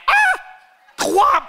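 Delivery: very high, loud shriek; hammed-up outraged yell; scandalized shout. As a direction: scream out the news.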